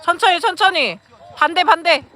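loud coaching shout; shouting to the court. Real, not acted. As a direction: call it across the gym.

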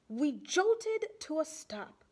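ordinary delivery

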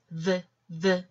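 V as a short voiced 브 with lip bitten; isolated v sound for V, not the letter name; letter sound demo. Shown as a demonstration. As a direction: sound out V twice, short and separate. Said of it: "비읍 발음".

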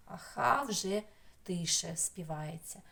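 usual speaking voice

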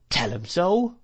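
affronted servant's comeback; retort. A narrator's reading. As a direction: throw it back with a sharp rise at the end. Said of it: "retorted Peggotty".

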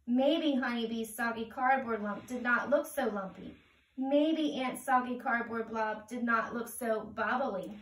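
usual speaking voice

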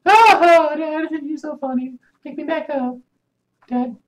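lightly voiced small child; kid voice. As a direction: play the son teasing his dad. Playing son talking to dad.

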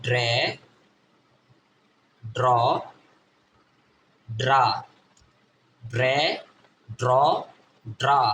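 ড্রে, ড্র, ড্রা as separate blended DR syllables; phonics drill. Shown as a demonstration. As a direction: chant ড্রে, ড্র, ড্রা one by one, spaced out. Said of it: "কি হবে?".

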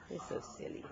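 whispering. Real, not acted. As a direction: breathe it in a whisper. Cooing to baby, whispered.

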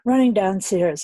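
usual speaking voice

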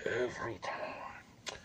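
slow, exasperated whisper; tired hushed groan. Real, not acted. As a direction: whisper it in weary disbelief.

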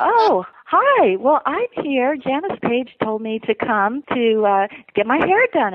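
high, slowed-down retold hello; awkward polite greeting. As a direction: cover the doubt with a high, drawn-out politeness. Retelling awkward reply to wrong Jose.

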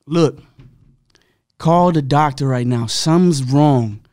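firm demand. Retold or imitated. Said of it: "I'm like".

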